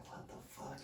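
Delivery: breathy whisper of shock; whispered disbelief. Retold or imitated. Retelling the mom's stunned reaction, whispering. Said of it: "she's just like".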